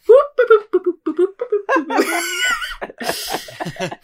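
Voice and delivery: long, high whooping laugh; howling laugh. Real, not acted. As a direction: howl with laughter. Long laugh after punchline.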